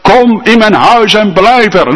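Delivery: high, loud, slow begging plea; pleading Lydia. Acted. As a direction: beg them to stay. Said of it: "smeekte zij ons". (Lydia smeekt luid en hoog)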